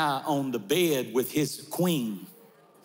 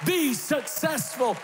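preaching at full force; 12 semitones higher than usual. Acted. Pastor lifting the congregation, full-force preaching. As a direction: build it like a revival preacher.

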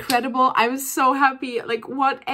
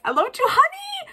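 1 giddy squeal. Real, not acted. Fan declaring love for her bias.

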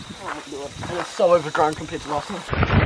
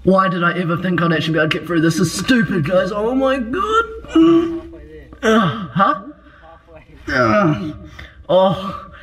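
echoing in a tunnel. Real, not acted. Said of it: "I can hear myself echoing".

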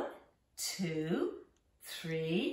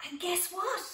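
excited tease; 10 semitones higher than usual. Acted. Reveal to young listeners.

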